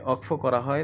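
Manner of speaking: ordinary delivery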